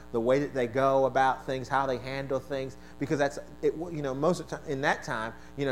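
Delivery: ordinary delivery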